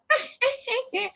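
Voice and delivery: put-on very high, light girly giggle; put-on feminine laugh; cunty laugh. Shown as a demonstration. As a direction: titter daintily for the demonstration. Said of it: "very, very cunty".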